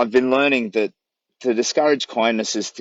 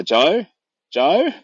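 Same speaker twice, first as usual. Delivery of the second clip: calling out; 7 semitones higher, 4 dB louder than usual. Retold or imitated. Calling out for lost guide.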